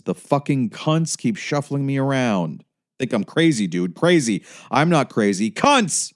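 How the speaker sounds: loud, high ranting shout. Acted. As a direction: bellow it like a furious biker. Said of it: "he shouted".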